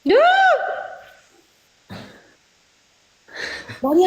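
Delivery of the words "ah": pained cry. Real, not acted. Relief, toothpaste removed.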